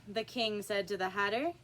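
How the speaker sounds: plain reading voice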